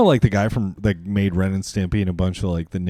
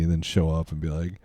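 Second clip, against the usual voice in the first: ordinary talk, nothing marked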